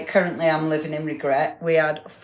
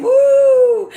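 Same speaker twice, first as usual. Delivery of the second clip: very high, loud whoop; excited woo; 10 dB louder than usual. Real, not acted. Host whoops for on-time viewer, loud and high. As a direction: whoop for a friend. Cheering a friend who's on time.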